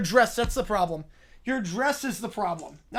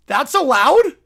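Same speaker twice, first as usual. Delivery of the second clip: louder, rising in disbelief; really can't believe it, louder now; loud incredulous question; 2 semitones higher, 9 dB louder than usual. Real, not acted. Streamer repeats it, loud and incredulous.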